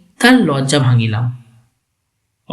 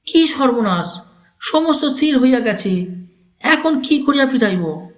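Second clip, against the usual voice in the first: put-on alarmed old uncle; 8 semitones higher than usual.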